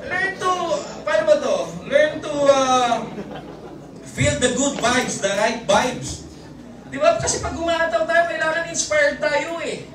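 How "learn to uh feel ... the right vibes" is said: slow, deliberate motivational line; slow inspirational delivery; slow slogan. Shown as a demonstration. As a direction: spell out the mantra word by word.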